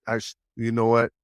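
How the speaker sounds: normal talking voice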